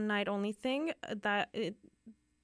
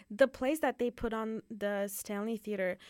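ordinary delivery

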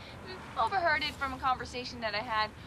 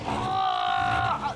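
loud, low effort groan; straining groan; 6 dB louder than usual. Acted. Kat heaving the fridge, loud low groan. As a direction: grunt with a heavy shove.

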